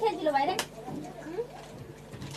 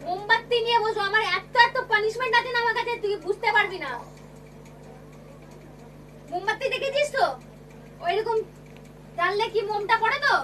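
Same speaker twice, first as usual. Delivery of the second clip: threatening scold; 4 semitones higher than usual. Real, not acted. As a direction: threaten a harsh punishment. Teacher threatening punishment. (রাগে চড়া গলায় হুমকি)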